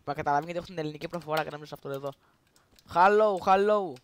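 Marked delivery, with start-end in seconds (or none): 2.9-3.9 loud broken English in a put-on Arabic accent; fake Arabic accent. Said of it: "I'm Arabic". (ψεύτικη αραβική προφορά, δυνατά)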